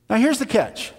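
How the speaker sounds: normal talking voice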